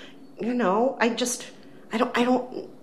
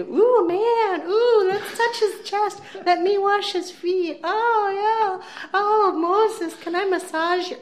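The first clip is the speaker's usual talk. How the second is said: put-on high, slow, swooning girlish coo; 13 semitones higher, 4 dB louder, slower than usual. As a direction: swoon over him like a silly handmaiden.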